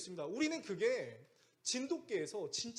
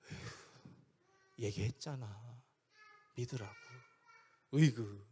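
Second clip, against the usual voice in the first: gentle chiding; 6 semitones lower, slower than usual. Acted. Preacher showing how Jesus really spoke to worried disciples, gentle chiding. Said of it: "이렇게 얘기하는 거예요".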